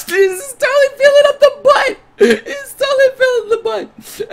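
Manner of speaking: very high, squeaky words through laughing; squealing laughter. Real, not acted. Streamer cracking up at the game scene, squealing laughter.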